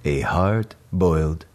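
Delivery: slow, low, deliberate language-tape voice; slow stiff language-tape narrator; language record voice. Retold or imitated. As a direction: read it slowly like an old French lesson record. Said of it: "the guy's going".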